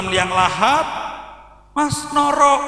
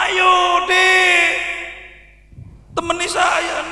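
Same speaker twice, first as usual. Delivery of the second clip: dead husband calling; 7 semitones higher, slower than usual. Retold or imitated. Dead husband calls his wife to join him, shouting. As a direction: beckon her like a ghost wanting company.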